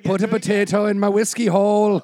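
mock Irish. Friend mimicking the comic's Irish, exaggerated accent. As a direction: ridicule a bad Irish accent. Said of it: "She's like".

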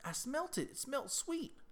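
a high-pitched imitation of the neighbour explaining earnestly; high mock neighbour.

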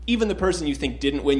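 normal talking voice

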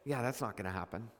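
low, quick scoffing brush-off; mocking dismissal. Mocking neighbours laughing at the promise, low and quick. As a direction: scoff at the promise like it's a joke. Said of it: "And they're like".

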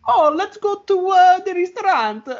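very high, slow cartoon Italian accent; hammed-up Super Mario Italian, loud and squeaky-high; cheesy Italian accent. Shown as a demonstration. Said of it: "very offensively cheesy, like, Super Mario accent".

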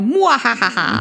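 hammed-up evil cackle; villain laugh. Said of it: "Apropos Muahahaha".

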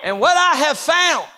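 high, shouted pulpit cadence; full-force preaching. Acted. Preacher citing scripture on God's ways, high impassioned preaching. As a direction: proclaim the scriptures in an unbroken, driving run.

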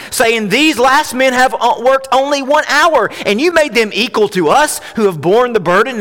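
ringing Bible reading, full projection; scripture reading. A narrator's reading. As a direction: proclaim the parable to the whole room. Reads the parable aloud to congregation.

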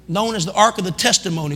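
normal talking voice

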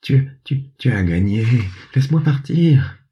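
terrified panther pleading to be let go; trembling plea. Acted. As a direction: beg for mercy, stumbling on the first word. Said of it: "Sa voix tremblante supplia".

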